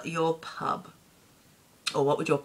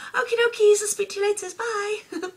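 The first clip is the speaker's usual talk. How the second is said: much higher, chirpy sign-off; singsong phone goodbye; 5 dB louder than usual. Retold or imitated. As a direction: chirp it like hanging up on mum. Ending phone calls with mum.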